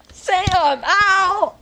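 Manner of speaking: high, hurt cry of protest; high-pitched outburst of pain; pained yelp. Real, not acted. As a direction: yelp at the friend hurting you. Hurt by his friend while recording.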